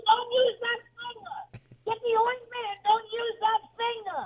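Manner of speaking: high, slow whining aunt voice; high aunt scolding. Retold or imitated. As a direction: nag like a fussy aunt. Aunt voice caught in background.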